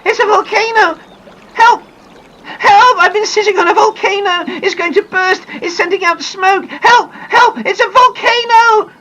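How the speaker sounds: high, loud panicked screams for help; shrieking girl fleeing down a hill; terrified screaming. Acted. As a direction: scream it as you run in terror. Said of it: "screaming loudly".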